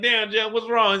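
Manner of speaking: high, loud worried question; alarmed call. Retold or imitated. Narrator seeing Jeff in pain, high alarmed question.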